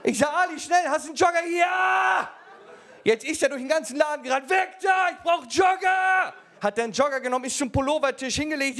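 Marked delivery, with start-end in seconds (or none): 0.3-1.4 loud, higher rushed urgency; urgent order to panicking colleague; urgent shout. Retold or imitated. Urgent reply. 1.4-2.3 shouted yes. Retold or imitated. Ali shouts. 4.5-6.3 loud, higher, slowed frantic yell; frantic shout. Retold or imitated. Running through shop.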